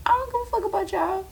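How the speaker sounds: "I don't give a fuck about y'all" whispered fast and high; whispered aside. Real, not acted. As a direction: toss it off as a quick secret whisper. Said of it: "repeated as quick aside".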